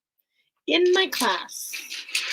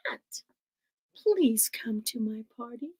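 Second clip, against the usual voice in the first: usual speaking voice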